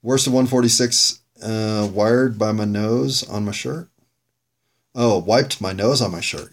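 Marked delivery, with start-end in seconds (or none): 1.9-3.9 slow, questioning read, rising at the end; puzzled reading. Real, not acted. Host puzzling over his own scrawled note, puzzled. 4.9-6.5 sudden recognition, falling at the end; realization. Real, not acted. Host working out his own note, realization.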